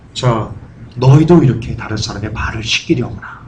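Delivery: low, soft voice of a teacher instructing followers; acted-out gentle low instruction; voice of Jesus. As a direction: give a quiet, solemn charge. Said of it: "예수님께서 뭐라고 얘기합니까?".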